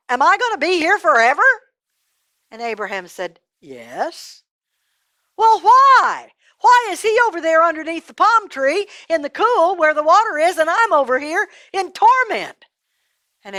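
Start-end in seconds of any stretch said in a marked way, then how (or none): 0.1-1.4 high, loud, rising question; alarmed question. Acted. Rich man asks in alarm. 3.6-4.4 low, soft verdict; grave confirmation. Acted. 5.4-12.7 high, loud, aggrieved demand; outraged protest. Acted. Rich man protests his fate.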